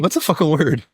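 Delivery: usual speaking voice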